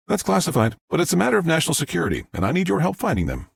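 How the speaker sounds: high, quick official reply; insistent woman agent. Acted. Agent tells the sheriff it is classified, insistent.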